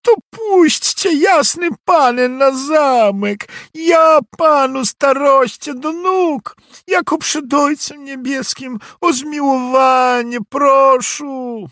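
high, loud desperate begging cry; pleading cry. A narrator's reading. Peasant girl crying out to the nobleman for mercy. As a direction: shriek for mercy at the lord's feet. Girl begs to enter castle.

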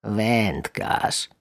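quiet, disdainful frog voice; a touch of disgust in one word; mild distaste. Acted. Big frog sniffs at the fisherman, lightly disgusted. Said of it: "odparła pierwsza z niesmakiem".

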